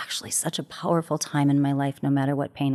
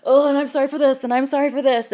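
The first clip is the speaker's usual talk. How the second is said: much higher and louder, giggly over-the-top sorry; laughing mock apology; 10 semitones higher, 5 dB louder than usual. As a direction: pile on the apologies through laughter. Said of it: "then we were like".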